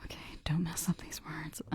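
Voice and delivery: hushed, whispery mutter; self-coaching aside. Acted. Reader coaching herself through the dialect, hushed self-talk. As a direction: whisper it as if to yourself. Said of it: "reading it like this".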